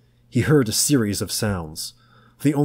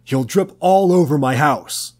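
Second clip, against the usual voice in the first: higher, louder disgusted shout; angry yell; 7 semitones higher, 7 dB louder than usual. A narrator's reading. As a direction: spit it out in disgust. Tom yelling in disgust.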